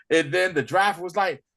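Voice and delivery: ordinary talk, nothing marked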